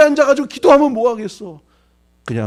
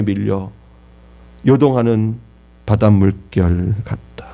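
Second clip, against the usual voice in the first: scripture recital; 12 semitones lower, slower than usual. Acted. Scripture recalled in prayer.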